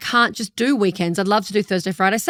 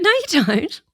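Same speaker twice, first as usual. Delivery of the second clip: higher, flatly insistent denial; insistent fan; 7 semitones higher, slower than usual. Retold or imitated. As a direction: contradict her like you know better. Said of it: "she went".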